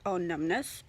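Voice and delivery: ordinary delivery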